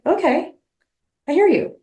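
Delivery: high, lilting reassurance; sing-song calm to settle a child; mock soothing. Reassuring her scared inner part, high and sing-song. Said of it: "I'm like".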